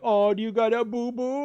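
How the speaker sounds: high, loud mock baby talk; imitating cooing mom. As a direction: coo at a child like an overdoing mom. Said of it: "my mom would be like".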